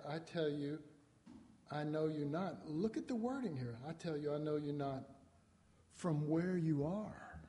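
ordinary delivery

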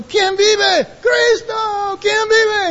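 high-pitched rallying shouts; yelled call-and-response. Retold or imitated. Preacher demonstrating how crowds get hyped up, high shouted chant. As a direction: trade shouts like leader and crowd. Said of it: "yell out real loud".

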